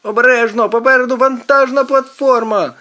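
high, loud alarmed shout; panicked yell, pitch way up; shouting a warning. A narrator's reading. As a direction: scream a warning to the driver. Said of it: "кричить Квінт".

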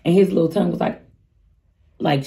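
ordinary talk

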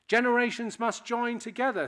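ordinary talk

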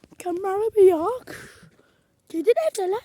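excited yell looking for the cat; loud outburst. Real, not acted.

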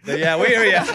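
loud, high burst of laughter; laughing. Real, not acted. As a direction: shout it out while cracking up. Host cracks up, answers caller.

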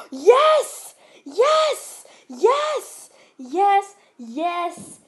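loud high cheering yes; victory shout. Real, not acted. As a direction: scream the win with relief. Surviving the night, celebrating.

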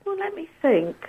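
usual speaking voice

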